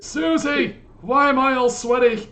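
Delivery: put-on high, loud confused old man; a bewildered old man in the bath.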